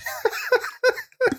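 high-pitched burst of laughter; squeaky real laughing fit. Real, not acted. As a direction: crack up, high and helpless. Cracks up at capitalism joke.

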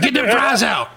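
high bossy voice; mock manager order. Manager yelling at the cook, high and bossy. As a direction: bark it at the cook.

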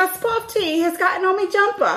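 high, hammed-up British dismay; put-on British tea-time voice; mock British accent. Continuing the British tea bit.

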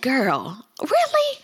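"really?" leaps way up in disbelief; incredulous. Retold or imitated. Host to Candace, incredulous "really?" jumping high. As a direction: play it like she can't believe what she's hearing. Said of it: "I said".

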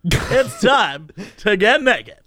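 slow, drawn-out presenter greeting; hammed-up host intro. Pretending to greet a date in show voice, hammed-up. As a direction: stretch out your show opening as a seduction joke. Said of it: "and I'm like".

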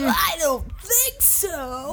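high, slow mock reply. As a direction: drag it out in a high voice.

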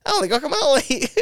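put-on high squeaky kid before puberty; prepubescent boy voice. Describing Elliot as a child doll, squeaky boy voice. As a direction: squeak like a boy whose voice hasn't broken. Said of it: "child mannequin doll waiting on voice to break".

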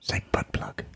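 unvoiced whisper. Real, not acted. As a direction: whisper it conspiratorially. Whispered aside.

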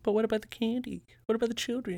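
high, soft mock pleading; mock dramatic concern. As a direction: fret like a worried parent. Mock pleading about candy.

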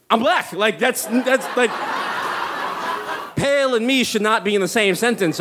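high-pitched incredulous objection; acted indignant bewilderment; baffled protest. Acts out baffled protest to nurse.

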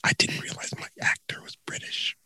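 hushed aside, barely voiced; whisper. Real, not acted. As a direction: whisper it so the round isn't disturbed. Aside during the timed game.

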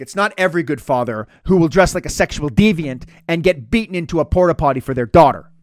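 ordinary talk

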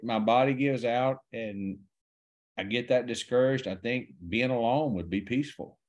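usual speaking voice

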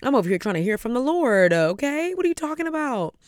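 loud, climbing high-pitched protest; retold indignation rising to a high squeal of disbelief; exasperated retort. Woman at prayer protesting to a deacon's interruption, exasperated.

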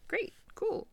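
high, mock-cheery great cool; sarcastic. Griping about Christmas at her in-laws, high mock-cheerful sarcasm. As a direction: fake delight, dripping with sarcasm. Sarcastic reaction to in-law visit.

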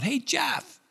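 high called name; mild mock call over a crowd. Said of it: "someone yells out".

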